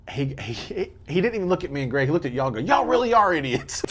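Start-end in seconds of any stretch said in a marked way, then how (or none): 2.6-3.8 high, slow, amused mock verdict; mock stranger verdict. Stranger tells the hosts they're idiots in a high, slow mock voice. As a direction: drag it out like a fan shaking his head.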